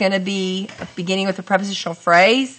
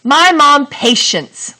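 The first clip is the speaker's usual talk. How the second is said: slow, higher, louder mock trial reading; testing a wrong sentence; 7 semitones higher, 4 dB louder, slower than usual. Shown as a demonstration. Teacher tries a wrong sentence for the class, slow and raised. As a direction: say it out to show it doesn't work. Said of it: "but I can't say".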